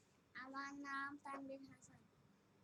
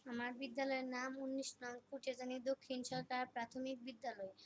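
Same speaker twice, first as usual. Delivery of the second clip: ordinary talk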